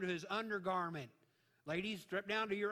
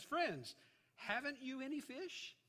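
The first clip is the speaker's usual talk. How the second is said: higher, teasing question with a rising end; mock-innocent sarcasm; 8 semitones higher, slower than usual. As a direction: rub it in with feigned innocence. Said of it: "there's probably some sarcasm in there".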